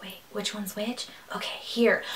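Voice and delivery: whispered, unsure muttering over coins; confused whisper. Retold or imitated. Shopper at a till whispering to herself, confused. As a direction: hush it like fumbling with change at a till.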